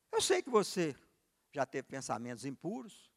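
ordinary talk, nothing marked

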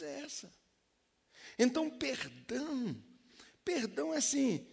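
high, rising preacher's call; fiery preaching. Real, not acted. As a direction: lift the congregation, urgent. Preaching climb, much higher pitch.

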